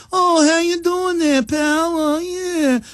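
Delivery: high, slow put-on New York Italian gangster accent; mock New York gangster accent. Host mocks a movie gangster, high slow New York Italian accent. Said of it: "Italian gangsters didn't really talk like that".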